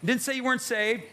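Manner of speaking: ordinary talk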